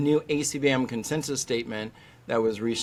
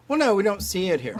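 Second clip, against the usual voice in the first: higher, quick dismissive reply; mimicked dismissal; 7 semitones higher, faster than usual. Vet at lecture denying local cases, mock dismissive. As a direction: imitate a doctor brushing it off. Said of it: "I always get that question".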